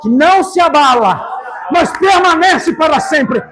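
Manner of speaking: high, ringing proclamation in drawn-out phrases; impassioned preacher at a climax; full-force preaching. Real, not acted. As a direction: shout the promise out to the whole church.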